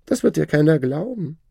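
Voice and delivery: high, lordly and condescending; haughty shadow. Acted. The Shadow lording it over the learned man, haughty high voice. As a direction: talk down to your old master.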